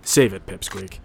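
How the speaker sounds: dismissive. Acted. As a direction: cop brushing off the suspect, low and dismissive. Cop dismissing suspect.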